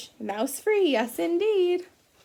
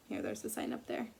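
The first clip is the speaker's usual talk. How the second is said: ordinary delivery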